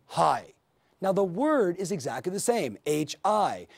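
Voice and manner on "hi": low, short HI; contrasting low greeting. Shown as a demonstration. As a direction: drop HI low and keep it brief. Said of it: "that's different from".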